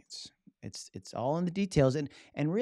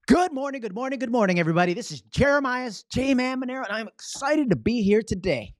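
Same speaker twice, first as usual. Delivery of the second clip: much higher, louder, bursting with energy; excited greeting; 12 semitones higher, 8 dB louder than usual. Shown as a demonstration. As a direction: greet the room like you can't contain yourself. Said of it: "body language that demonstrates my excitement".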